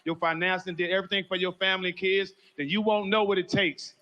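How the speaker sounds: loud, driving preacher's build over every line; preaching at full force. Acted. Friend preaching loud at a funeral for everyone. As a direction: testify loud and high to the whole room. Opening lines of a funeral tribute.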